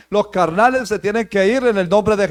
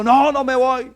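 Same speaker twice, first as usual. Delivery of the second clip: demon refusing, pitched higher; defiant demon; 4 semitones higher than usual. Retold or imitated. Demon answers the leader casting it out, defiant. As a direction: refuse stubbornly as a retold demon. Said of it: "el demonio llegó y dijo".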